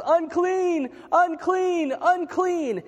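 high, loud imitation of a leper's warning cry; retold scream. Preacher acting out a leper warning passers-by, retold scream. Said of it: "to scream at the top of their lungs".

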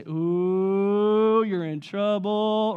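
long drawn-out "ooh", slow taunt; schoolyard teasing. Acted. Classmates react to the principal's summons, drawn-out teasing. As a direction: tease like a classroom full of kids.

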